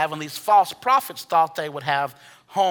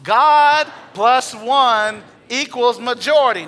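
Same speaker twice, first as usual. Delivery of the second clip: louder, slow, emphatic, higher and forceful; like you mean it; 5 semitones higher, 7 dB louder, slower than usual. Shown as a demonstration. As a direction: proclaim it as if you truly believe it. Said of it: "Now say it like you mean it".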